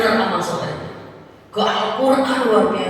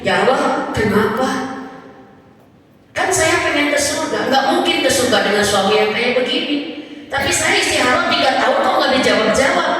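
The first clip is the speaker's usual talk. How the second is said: wife's desperate plea; 7 semitones higher, 5 dB louder than usual. Retold or imitated. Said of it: "Dia berkata gini".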